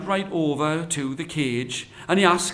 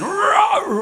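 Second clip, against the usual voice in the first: high, loud imitated tiger roar; 8 dB louder than usual. Caged tiger about to greet the Brahmin, roaring. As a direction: let out a big roar. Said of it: "just about to roar again".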